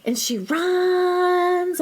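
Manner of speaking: high, stretched-out wail through the words; imitated running yell. Host retells mom running down the stands, mock yell. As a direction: stretch RUNS into a long mock scream. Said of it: "So the scream, the yell".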